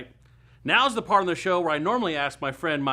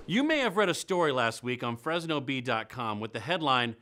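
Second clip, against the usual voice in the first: usual speaking voice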